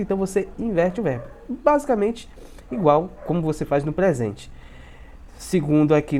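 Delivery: normal talking voice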